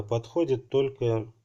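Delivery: ordinary delivery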